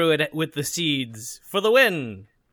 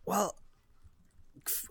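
ordinary talk, nothing marked

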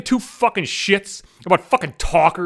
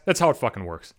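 low muttered aside; 8 semitones lower, 5 dB quieter than usual. Real, not acted. Telling off the defenders, a low muttered aside. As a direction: lower and softer, under the breath. Repeats the line in two deliveries.

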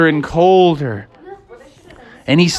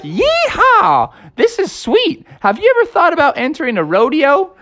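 very high yeehaw then fast excited chatter; cowboy whoop; 13 semitones higher than usual. Acted. Froggy gallops through snow, very high excited whoop. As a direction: whoop like a thrilled little cowboy.

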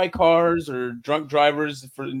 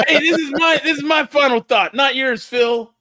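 higher, louder mock-indignant protest; 9 semitones higher, 7 dB louder than usual. Real, not acted. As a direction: insist it's your turn to talk. Cut off, claims his turn.

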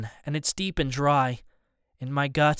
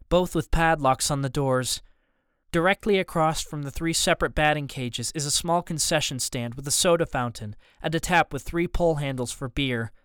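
ordinary delivery, nothing marked

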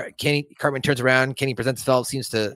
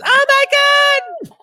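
very high, loud cartoon scream; mock scream; 8 dB louder than usual. Kenny screaming at Satan, high mock scream. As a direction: imitate a terrified shriek. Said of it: "And then Kenny screams".

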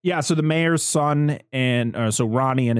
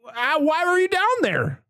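high, frantic mock yell at a friend; hammed-up alarm, voice jumping up high; mock panicked shout; 4 dB louder than usual. Friend mocking her own panic at a movie scene, frantic high yell.

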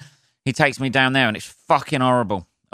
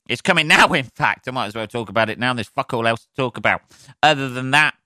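normal talking voice

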